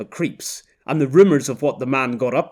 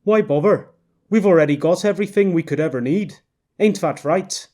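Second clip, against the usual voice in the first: higher, louder and faster, dismissive; Canadian husband; 5 semitones higher, 4 dB louder than usual. Acted. Husband dismissing his wife's suggestion, fast and dismissive. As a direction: brush it off quickly, Canadian gruffness.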